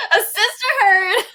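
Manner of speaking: giggling through the words; laughing. Real, not acted. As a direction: laugh while saying it. Laughing repeat of pun.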